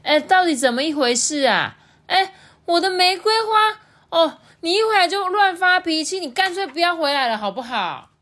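high, loud, indignant mom; 妈妈着急发火. A narrator's reading. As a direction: rush in and scold your husband shrilly. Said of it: "急急忙忙从厨房跑出来". (妈妈又高又大声地责骂)

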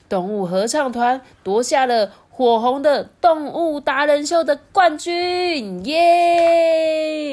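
slow, high victory announcement; triumphant cheer. A narrator's reading. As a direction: proclaim the winner and cheer. Announcing the champion.

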